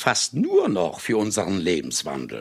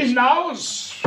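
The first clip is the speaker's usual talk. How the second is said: sharp dismissal; 13 semitones higher than usual. Acted. Orders servant out.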